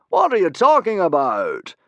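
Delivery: loud, high puzzled; be baffled; surprised. A narrator's reading. Humongous puzzled by Fishlegs' complaint, surprised. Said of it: "said Humongous in surprise".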